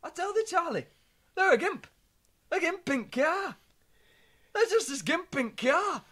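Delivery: put-on a shocked old father insisting; dad tells son about the gimp, mock-amazed; dad's voice. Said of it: "He was like".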